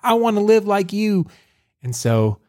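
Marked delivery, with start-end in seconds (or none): 0.0-1.3 mock pleading girl. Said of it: "Sophia's like".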